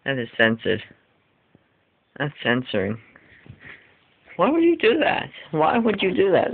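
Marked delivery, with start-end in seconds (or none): 4.4-5.3 high, shocked cry of disbelief; appalled outcry. Real, not acted. Appalled at the censored toy. 5.5-6.5 shocked repeat. Real, not acted. Repeats the outcry.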